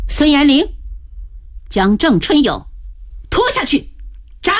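high-pitched execution order; called-out command. A narrator's reading. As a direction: call the order in short bursts. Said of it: "叫道". (高声喝令行刑)

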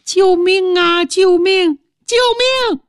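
stepmother from the pigsty, screaming for help; cry for help. Acted. Stepmother trapped in the pen, shouting for help. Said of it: "就听有人喊".

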